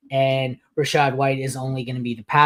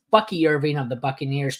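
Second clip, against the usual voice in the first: ordinary talk, nothing marked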